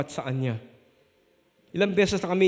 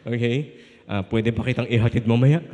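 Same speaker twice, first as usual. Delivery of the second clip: lower, timid courting voice; nervous suitor voice; 6 semitones lower than usual. Retold or imitated. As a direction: drop the voice to ask her out shyly. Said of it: "na medyo yung boses ko".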